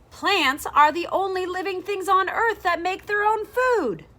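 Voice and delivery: loud and high, like a proud announcement; quite loudly. Acted. The Cat announces the fact to the kids, loud and high. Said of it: "I'll say this quite loudly".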